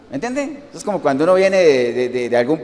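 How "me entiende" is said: usual speaking voice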